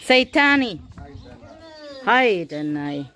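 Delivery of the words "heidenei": slow, drawn-out Heidenei, said once more on request. Shown as a demonstration. Asked by a friend to say it again: slow drawn-out Heidenei. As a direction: stretch each syllable of Heidenei slowly. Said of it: "Sag's nochmal".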